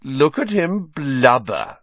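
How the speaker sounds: slow, high, drawn-out mockery; jeering. Malfoy mocks Hagrid crying, slow and sneering. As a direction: draw it out to mock him.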